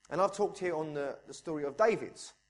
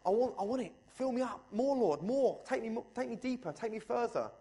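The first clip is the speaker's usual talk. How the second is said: high, pleading cry for more; crying out to God; 7 semitones higher than usual. Retold or imitated. Preacher acts out his own high pleading prayer to God. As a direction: beg the Lord to fill you up. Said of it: "And I go".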